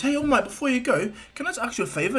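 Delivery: high, hopeful fanboy voice; Izuku. Acted. Boy asking his idol a favor, high and hopeful. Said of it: "And Izuku goes".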